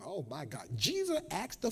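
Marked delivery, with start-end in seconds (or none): none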